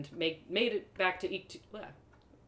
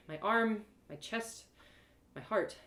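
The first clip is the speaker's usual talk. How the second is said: ordinary delivery, nothing marked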